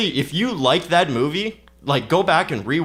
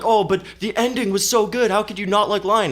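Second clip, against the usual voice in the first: higher, gushing mock-fan voice; 8 semitones higher than usual. Critic mocks moviegoers who loved the Lion ending, in a high gushing voice. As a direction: parody the teary crowd leaving the theater. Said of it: "thinking that they're like".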